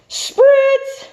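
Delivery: very high, loud burst; exclaimed spritz. Acted. Stickel spraying the tomatoes, loud high exclamation. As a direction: fire off the word like a spray.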